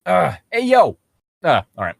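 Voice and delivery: put-on lightly done Brooklyn accent, rising on yo; a quick stab at a Brooklyn greeting. Reader tries Dalamar in a Brooklyn accent, a light one. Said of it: "Brooklyn accent".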